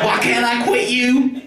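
mock the film's longing line; Brokeback Mountain quote. Doing a Brokeback Mountain line for the crowd, slow and pleading. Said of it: "Brokeback Mountain".

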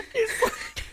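a very high shrieking laugh that nearly cries. Real, not acted. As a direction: lose it completely, shrieking. Laughing hysterically.

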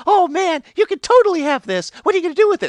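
high, loud, eager offer; giddy pixie. Retold or imitated. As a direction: hand it over with delight.

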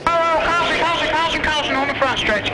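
high, urgent caution call; race spotter radio. Real, not acted. Race official calling a caution.

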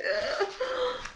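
high, giggly laugh of delight; giddy laugh. Real, not acted. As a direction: giggle with happiness. Overjoyed by the gifts.

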